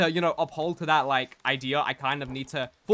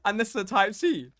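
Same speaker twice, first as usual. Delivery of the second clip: panicked realization; 5 semitones higher, 4 dB louder than usual. Retold or imitated. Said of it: "And then I realize".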